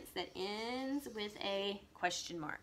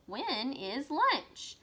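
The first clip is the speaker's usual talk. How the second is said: When is lunch? read very slowly, a little higher, lunch stressed; slow, slightly higher question read; 4 semitones higher, much slower than usual. Shown as a demonstration. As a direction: model the question slowly for children. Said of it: "This is a question because it's asking".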